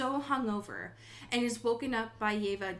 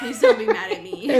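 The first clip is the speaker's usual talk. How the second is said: laughing plea; 9 semitones higher, 11 dB louder than usual. Retold or imitated. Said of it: "I'm ten years old".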